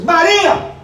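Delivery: high loud call of "Maria!"; firm loud call of her name. Retold or imitated. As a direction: call her back to reality. Retold calling her name.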